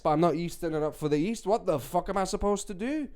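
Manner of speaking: ordinary talk, nothing marked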